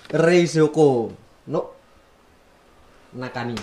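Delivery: loud, drawn-out Japanese with a Javanese accent; Indonesian accent. Real, not acted. Vlogger naming the fridge in Japanese for viewers, Indonesian accent. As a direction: Japanese phrase in an Indonesian accent, said slowly and loudly. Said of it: "Logatnya masih logat Indonesia".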